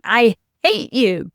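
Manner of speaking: slow, loud I hate you leaning on hate; mock tantrum, hate hit hard and falling; imitating child's outburst. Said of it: "then came the big one".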